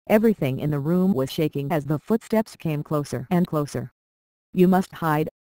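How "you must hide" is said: lightly urgent warning; a restrained cry to take cover; mild cry of warning. A narrator's reading. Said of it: "cried the Golden Harp".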